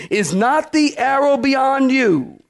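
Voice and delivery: calling out. Acted. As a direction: call to the boy far away. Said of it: "Jonathan cried out after the lad".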